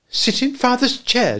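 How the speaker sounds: high, slow shocked mother; scandalised. A narrator's reading. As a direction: gasp it out, appalled. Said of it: "Wendy was scandalised".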